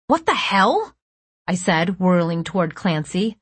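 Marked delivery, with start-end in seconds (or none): 0.1-1.0 high, indignant question; outraged disbelief. A narrator's reading. Narrator whirling toward Clancy, outraged. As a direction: round on them in disbelief.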